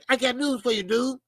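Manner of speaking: normal talking voice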